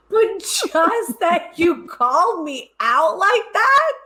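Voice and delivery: high, drawn-out laughing squeal; laughing disbelief. Real, not acted. Laughs at being called out.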